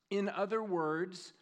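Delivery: ordinary talk, nothing marked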